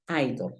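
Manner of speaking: "idol" said "ai-dol"; low, soft "idol" with unchanged O; O sounds the same. Shown as a demonstration. Teacher completing the word, "idol" as ai-dol. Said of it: "la O suena igual".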